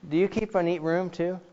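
ordinary talk

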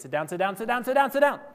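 mock parent hammering "sit down", pitch rising with each repeat; emphatic repetition. Parent talking to kids, "sit down" repeated with mounting emphasis. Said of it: "put emphasis on something, you're gonna repeat it".

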